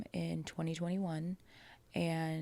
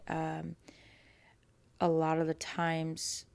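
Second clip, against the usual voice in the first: normal talking voice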